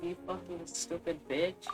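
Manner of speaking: ordinary talk, nothing marked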